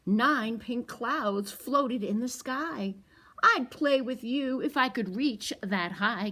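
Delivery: picture book read to toddlers; storytime reading. A narrator's reading. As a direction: read it slowly and big for the kids. Reads the picture book aloud.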